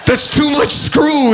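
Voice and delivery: high, shouted disbelief; hammed-up scoffing yell; mock shout. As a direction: bellow it in outrage.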